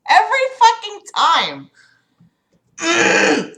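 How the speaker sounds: high, fast laugh-cry of frustration; exasperated laugh. Real, not acted. Streamer at her wits' end over echo, laughing despair.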